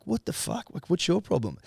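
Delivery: high-pitched retold exasperation; incredulous challenge, pitched right up; exasperated retort. Driver to man who won't move, retold exasperation.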